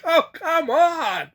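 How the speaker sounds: high, drawn-out mock pleading; exasperated protest. Passenger turned away over weight, exasperated protest.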